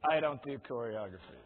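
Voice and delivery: low, put-on voice of inner refusal; inner voice of resistance. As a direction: drop the pitch for a grumbling inner objection. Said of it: "that voice in your head".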